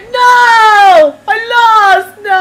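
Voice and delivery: high, loud crying-out of defeat; anguished wail. Real, not acted. Gamer howling high and loud after losing the race.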